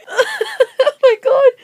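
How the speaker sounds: high voice overtaken by laughing; trying to recover from a laughing fit; crying with laughter. Real, not acted. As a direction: apologise through real laughter. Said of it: "I'm crying".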